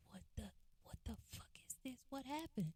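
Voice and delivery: hushed, breathless whisper of disbelief; shocked whisper. Retold or imitated. Kids peeking out the door at the police car, shocked whisper. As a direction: whisper it fast, stunned at what you see.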